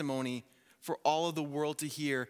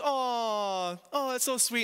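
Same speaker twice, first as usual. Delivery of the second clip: aww; 8 semitones higher, 3 dB louder, slower than usual. Retold or imitated. Family cooing at child's prayer.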